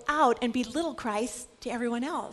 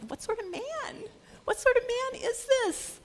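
awed disbelief; 11 semitones higher than usual. Acted. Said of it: "And they said".

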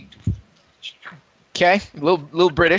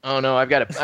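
ordinary talk, nothing marked

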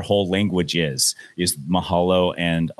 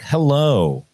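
pointed plain hello; 7 semitones higher than usual. Retold or imitated. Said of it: "I'd be like".

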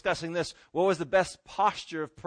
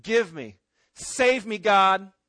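bossy, raised-voice order; demanding; 7 dB louder, much slower than usual. Acted. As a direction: build to a forceful shout. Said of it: "we shouldn't come and say".

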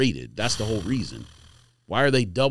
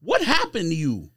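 pitched up high, exasperated disbelief; incredulous rant; 10 semitones higher than usual. Real, not acted. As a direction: rant like you can't believe your ears. Indignant rant about bad picks.

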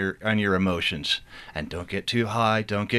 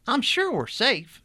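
much higher, slow reassuring sister; a pious sister calming everyone, high and slow; higher character voice; 14 semitones higher, slower than usual. Acted. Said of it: "doing a multitude of voices".

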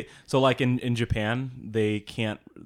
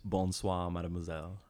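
French accent; 3 semitones lower, 7 dB quieter than usual. Shown as a demonstration. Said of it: "all you need to know for French".

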